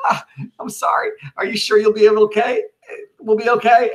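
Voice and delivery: very high, crying-voiced hammed-up sorry; mock sobbing apology. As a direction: blubber an apology through fake tears. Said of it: "Cassius just said".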